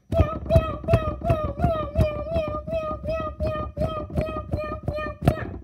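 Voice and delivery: high, loud sung meows; vocal cat piano meows. Pretend. Playing a pretend cat piano, very high, loud meows. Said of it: "do it with my voice".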